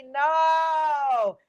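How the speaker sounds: a loud flat no; emphatic no. Real, not acted. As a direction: shout the refusal. Emphatic refusal, shouted no.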